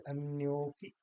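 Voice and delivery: अन्योपि with यो held; one-word अन्योपि with a lengthened o; joined long o. Shown as a demonstration. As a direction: stretch the यो of अन्योपि. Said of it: "लंबा ले रहे हैं".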